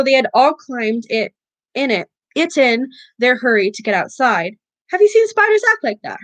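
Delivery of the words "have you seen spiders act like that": high, quick, amazed question; lightly voiced wondering girl. A narrator's reading. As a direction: marvel at the spiders. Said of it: "said Hermione wonderingly".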